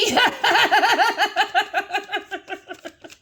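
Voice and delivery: loud high cackle; cackling at her own teasing; high cackling laugh. Real, not acted. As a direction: cackle at the rhyme. Laughs after wanky rhyme.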